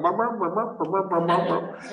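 imitating garbled, babbled speech; mock gibberish impression; unintelligible speaker. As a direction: babble nonsense syllables like an incomprehensible old-timer. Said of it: "didn't understand a thing he'd say".

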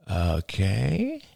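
hesitant okay, pitch lifting at the end; cautious murmur. A narrator's reading. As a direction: draw it out warily, rising. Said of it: "he murmured cautiously".

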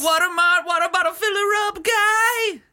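high, loud announcer shout. Pretend. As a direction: belt it like a hammy commercial. Ad parody, louder, higher.

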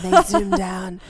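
imitating a wildlife documentary narrator; lightly voiced nature narrator; David Attenborough. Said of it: "Sounded very like David Attenborough".

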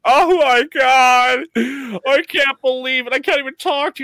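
high, slow shrieking scandalised fan; mock outraged fan. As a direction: ham up a fan losing it over a photo. Imitating outraged internet fans.

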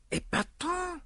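murmured French exclamation, pitched high; high, hushed French aside; soft murmur. A narrator's reading. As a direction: softly marvel in a French accent. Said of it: "murmured Poirot".

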